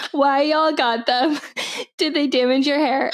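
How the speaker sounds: barely holding the laugh in; fighting real laughter. Real, not acted. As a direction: try to read seriously through giggles.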